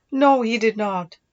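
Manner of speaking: disappointed. A narrator's reading. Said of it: "with a note of disappointment in her voice".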